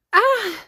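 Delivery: short high-pitched loud scream. Acted. Someone screaming unseen in the castle, high scream. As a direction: let out a sudden high scream. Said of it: "Who's screaming?".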